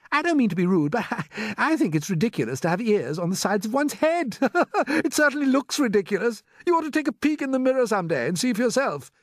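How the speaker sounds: high, loud, laughing jeer; mocking centipede. A narrator's reading. Centipede ridiculing ears on heads, high and laughing. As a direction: break into laughs while mocking.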